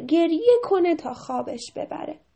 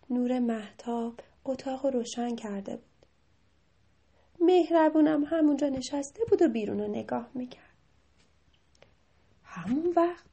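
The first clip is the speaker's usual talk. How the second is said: plain reading voice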